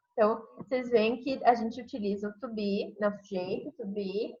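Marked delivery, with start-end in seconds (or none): none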